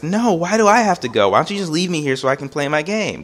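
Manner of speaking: put-on high-pitched kid complaint; whiny protest. Child begs mom to leave him with his game, whining. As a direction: plead and whine like a put-upon child.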